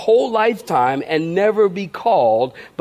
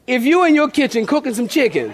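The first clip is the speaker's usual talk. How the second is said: laughing; 6 semitones higher, faster than usual. Real, not acted. Laughing while setting up joke.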